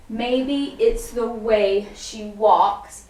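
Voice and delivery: very slow, drawn out with the moves; slow counted lyric. Shown as a demonstration. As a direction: stretch each word to the beat. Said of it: "So it's".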